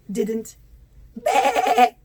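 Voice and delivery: plain reading voice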